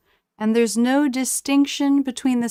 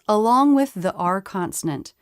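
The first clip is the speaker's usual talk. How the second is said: ordinary talk, nothing marked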